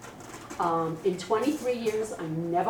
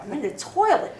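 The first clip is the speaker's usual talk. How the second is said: indignant; 9 semitones higher, 6 dB louder than usual. Retold or imitated. Indignant retold inner reaction.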